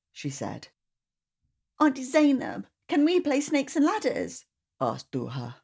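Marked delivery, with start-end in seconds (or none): 1.8-4.4 put-on high little-girl plea; eager child voice; child asking. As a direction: ask like a small girl wanting to play.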